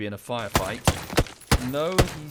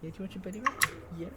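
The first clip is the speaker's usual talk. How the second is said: higher, softer pet talk; 5 semitones higher, 7 dB quieter, faster than usual. Real, not acted. Owner offers her dog belly rubs in higher, soft baby talk. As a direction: sweetly offer the dog a treat.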